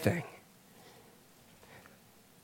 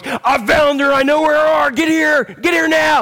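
Kiefer voice; 9 semitones higher than usual. Retold or imitated. Preacher doing Kiefer Sutherland, shouting orders. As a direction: bark orders like a TV hero in crisis. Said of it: "in my best Kiefer voice".

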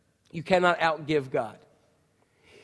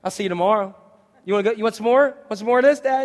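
loud, higher taunting; gloating kid son; 4 semitones higher, 6 dB louder than usual. Retold or imitated. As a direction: rub it in playfully. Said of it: "he's like".

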